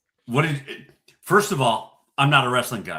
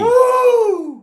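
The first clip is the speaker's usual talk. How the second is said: cheer; 11 dB louder than usual. Real, not acted. Cheering the Vikings lead.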